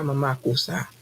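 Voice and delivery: ordinary talk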